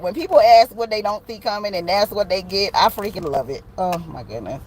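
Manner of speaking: ordinary talk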